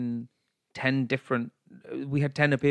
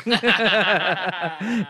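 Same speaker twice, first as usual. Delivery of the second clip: laughing; 6 semitones higher, 5 dB louder than usual. Real, not acted. Laughs after line.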